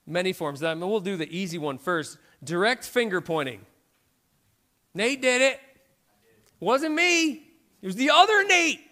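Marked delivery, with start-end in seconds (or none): 4.9-8.8 put-on high, loud kid denying it, laughing at the end; childish denial. A caught kid blaming the other Nate, high and laughing. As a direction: squeal out the excuse like a caught kid. Example of finger pointing.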